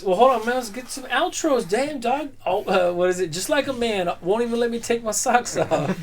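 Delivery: high, playful put-on complaint; mock whiny voice. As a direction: whine like a nagged partner. Mock complaint about being rushed.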